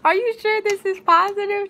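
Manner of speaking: high, strained anxious question; desperate for a different answer; anxious disbelief. Retold or imitated.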